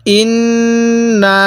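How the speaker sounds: ইন্না drawn out with nasal hum; slow recitation. Shown as a demonstration. Teacher recites ইন্না again for students. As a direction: stretch ইন্না slowly. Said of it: "তাহলে".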